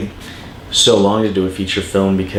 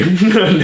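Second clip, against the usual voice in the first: short laugh; 10 semitones higher, 4 dB louder than usual. Real, not acted. Laughs at himself.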